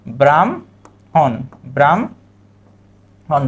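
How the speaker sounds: ব্রাহ্মণ said very slowly as bramhon, ম before হ; slow model pronunciation. Shown as a demonstration. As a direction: draw out ব্রাহ্মণ as bram-hon, m then h. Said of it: "শব্দটার উচ্চারণ হবে কি?".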